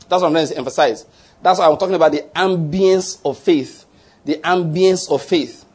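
ordinary delivery

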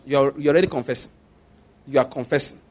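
normal talking voice

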